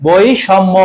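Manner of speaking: বৈষম্য slowly split as "boi-shommo"; slow teaching বৈষম্য with a clear sh; syllable-by-syllable pronunciation. Shown as a demonstration. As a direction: draw out বৈষম্য in careful parts. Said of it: "তারপর হচ্ছে কি হবে".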